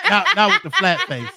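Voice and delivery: high, loud, laughing jab; laughing tease. Real, not acted. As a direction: tease him through a laugh. Teasing friend amid laughter.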